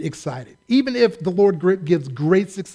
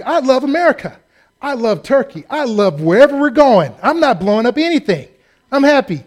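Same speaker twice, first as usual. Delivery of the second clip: loud, eager over-the-top patriotism; hammed-up cheer; 6 semitones higher, 6 dB louder than usual. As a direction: oversell how harmless and happy you are. Overdoing innocence at airport security.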